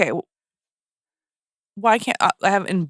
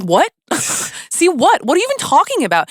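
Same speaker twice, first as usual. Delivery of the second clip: high, loud, fast disbelief; exasperated, high-pitched bafflement played for laughs; mock incredulous; 11 semitones higher, 3 dB louder than usual. Acting out baffled reaction to dialogue.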